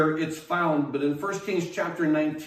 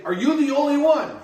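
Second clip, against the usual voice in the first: higher, louder, fast incredulous question; 9 semitones higher, 6 dB louder, faster than usual. Retold or imitated. Crowd asking Paul in disbelief, high and fast. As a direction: toss it out in disbelief.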